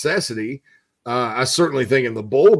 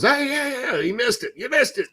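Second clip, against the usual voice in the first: excited; 12 semitones higher than usual. Retold or imitated. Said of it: "we jumped up out of our seat".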